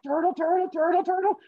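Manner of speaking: high, squeaky rhythmic chant; very high-pitched patter of effort; running turtle. Acted. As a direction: chug along as a cartoon turtle at top effort. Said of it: "And the turtle's like".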